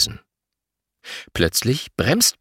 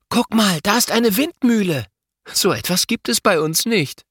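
calling out; 11 semitones higher than usual. A narrator's reading. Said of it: "Plötzlich ruft Louis".

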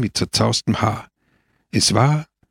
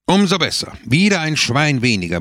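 kurz höher ansetzen, gespielt wütend; brüllend; 7 semitones higher than usual. A narrator's reading. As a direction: halb gespielter Aufschrei. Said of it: "brüllte Pepone". (Pepone brüllt Don Camillo gespielt an)

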